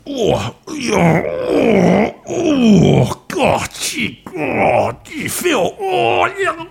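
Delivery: high, hammed-up moans of pleasure; mock ecstatic moaning. Host fakes rapture on air, hammed-up high moaning.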